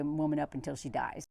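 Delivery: usual speaking voice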